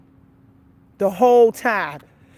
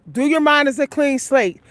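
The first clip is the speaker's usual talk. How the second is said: ordinary talk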